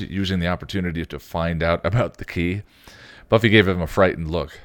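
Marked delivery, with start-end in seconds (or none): none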